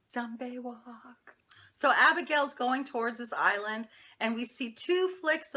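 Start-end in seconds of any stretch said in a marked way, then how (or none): 0.1-1.3 slow, drawn-out whisper; hushed, lingering catchphrase whisper. Acted. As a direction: whisper it slowly, stretching the words. Whispered show catchphrase between recap segments.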